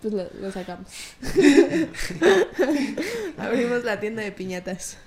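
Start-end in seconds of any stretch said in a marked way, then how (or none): 0.9-3.3 high bright burst of laughter; real amused laugh. Real, not acted. Laughs at own idea.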